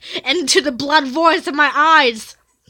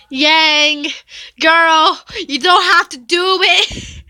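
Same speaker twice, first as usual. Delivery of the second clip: pleading; 5 semitones higher, 3 dB louder, much slower than usual. Real, not acted. Pleads with the character.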